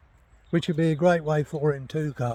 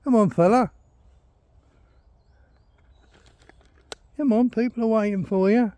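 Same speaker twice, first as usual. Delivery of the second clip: raised, high call to a lagging dog; 8 semitones higher, 7 dB louder than usual. Real, not acted. Owner calls his dog to catch up, loud high call.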